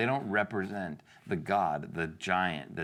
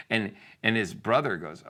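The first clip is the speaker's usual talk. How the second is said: ordinary talk, nothing marked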